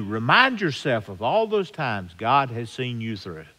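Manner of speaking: ordinary talk, nothing marked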